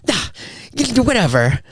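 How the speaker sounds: high-pitched mock brush-off; huffy, high impression of a miffed partner; imitating dismissive fiancee. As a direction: wave it away in a high mimicked voice. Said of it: "like that".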